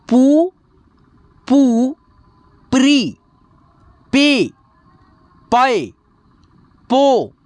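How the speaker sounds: slow teacher chanting each syllable apart; syllable chart recitation. Shown as a demonstration. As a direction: drill the barakhadi one syllable at a time.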